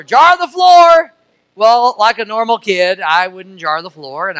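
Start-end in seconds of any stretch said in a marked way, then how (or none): 0.0-1.1 mom's holler. Retold or imitated. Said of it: "she'd holler".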